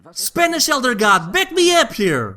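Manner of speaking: high, pleading call-out. Real, not acted. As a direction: call out for backup. Calling out to another dub.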